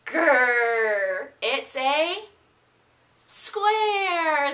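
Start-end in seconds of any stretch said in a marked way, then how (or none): 0.0-1.3 loud playful bear growl. Pretend. Quizzing kids on shapes, loud playful growl.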